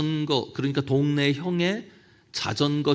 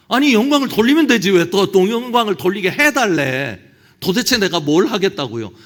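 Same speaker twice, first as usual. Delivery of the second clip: higher and louder, exasperated disbelief; incredulous outburst; 4 semitones higher, 6 dB louder than usual. Real, not acted. Preacher mocking the prayer, loud incredulity. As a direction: throw up your hands at the absurdity.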